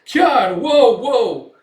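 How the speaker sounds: high, loud cries to agitated horses; alarmed shouting. Retold or imitated. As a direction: shout to halt a panicking animal. Horses agitated, driver calls out.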